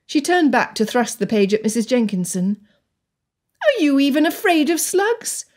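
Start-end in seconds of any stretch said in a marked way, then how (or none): 3.6-5.4 put-on higher, indignant girl. A narrator's reading. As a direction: demand it with indignation. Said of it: "Indignation filled Anne".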